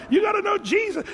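high, loud preaching from the car; imitating the driver shouting. Pastor mimicking a driver who is shouting about Jesus. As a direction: yell the good news like a joyful driver.

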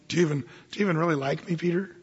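quick pleading. Retold or imitated. Paraphrase of Jesus' question.

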